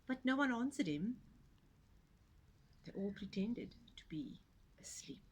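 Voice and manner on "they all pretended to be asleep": hushed narrator whisper. A narrator's reading. Narrator whispering that the animals fake sleep. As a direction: breathe it out softly, secretly.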